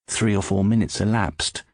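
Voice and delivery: ordinary delivery, nothing marked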